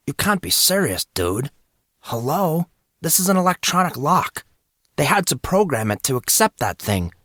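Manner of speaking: a hint of a sarcastic young guy. Monson voiced by narrator.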